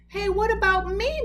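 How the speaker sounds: muffled, high cry from inside. A narrator's reading. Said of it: "Moose muffled voice cried".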